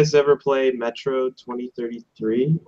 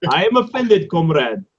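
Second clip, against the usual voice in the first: much higher, slow, laughing fake Russian; hammed-up offended comrade; mock Russian accent; 10 semitones higher, slower than usual. Player mocking his friend's accent, hammed-up Russian. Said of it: "turning into Borat".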